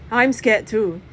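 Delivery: usual speaking voice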